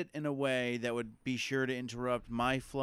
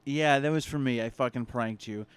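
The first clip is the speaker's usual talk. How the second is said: ordinary talk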